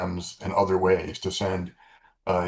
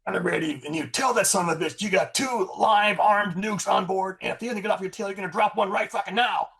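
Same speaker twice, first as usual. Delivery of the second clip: high-pitched, forceful shouted order; retold shout, deadly earnest; dead serious; 12 semitones higher than usual. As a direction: imitate a commander issuing an ultimatum. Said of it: "he was, he was dead serious".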